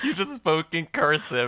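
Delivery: talking through laughter; laughing while you say it. Real, not acted.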